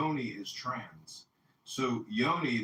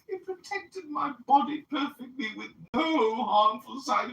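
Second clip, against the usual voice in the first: high, hammed-up English accent, mocking; 13 semitones higher, 7 dB louder than usual. To the podcast host, mocking a man's vaccine defence in an English accent. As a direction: send up his posh English lecture in a fake English accent. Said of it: "mocking him in my English accent".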